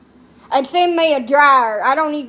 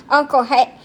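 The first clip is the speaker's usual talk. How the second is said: ordinary talk, nothing marked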